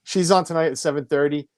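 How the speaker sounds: usual speaking voice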